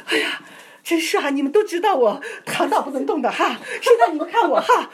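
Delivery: high, loud and laughing; healed sister showing off to her friends, thrilled; excited. Retold or imitated. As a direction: bubble over with delight. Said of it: "这个姊妹她就兴奋的".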